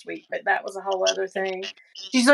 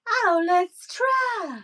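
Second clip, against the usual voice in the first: mock hesitant voice; 10 semitones higher, much slower than usual. Mocking someone's hesitant voice.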